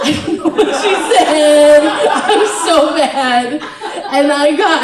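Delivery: high, loud wail through laughter; worked-up, laughing protest with a drawn-out end; comic exasperation. Retold or imitated. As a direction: cry out in laughing frustration. Exasperated over not understanding her.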